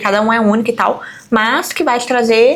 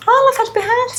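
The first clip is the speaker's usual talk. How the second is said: mock dismissive voice; 13 semitones higher than usual. Others commenting on child's tantrum.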